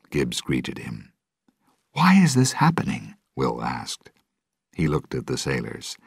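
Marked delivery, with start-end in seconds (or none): 1.9-3.1 alarmed question. Acted. Captive Will asks in alarm.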